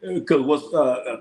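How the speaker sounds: ordinary talk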